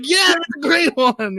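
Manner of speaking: high, drawn-out mock enthusiasm; gushing fans. Girl customers approve of the clerk's pick, gushing. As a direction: imitate an over-eager fan, high and slow. Eager fans praise the manga.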